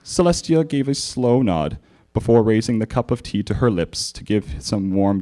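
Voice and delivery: usual speaking voice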